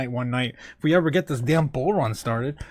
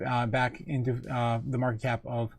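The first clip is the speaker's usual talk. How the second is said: usual speaking voice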